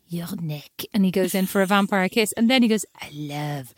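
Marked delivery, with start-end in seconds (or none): 0.1-0.7 hushed, low mock seduction; imitating a sleazy whispered come-on; creepy seductive whisper. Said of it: "says creepy things like". 3.0-3.7 slow, hushed sleazy come-on; mock-seductive slow whisper; creepy seductive whisper. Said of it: "says creepy things like".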